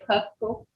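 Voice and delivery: Pasco with a puffed English P; common mispronunciation. Shown as a demonstration. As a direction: say Pasco with a breath burst on P. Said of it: "There's no such word as Pasco".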